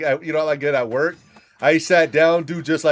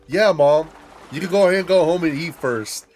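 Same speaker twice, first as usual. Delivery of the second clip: sarcastic; 5 semitones higher, 4 dB louder than usual. Retold or imitated. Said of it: "I was like".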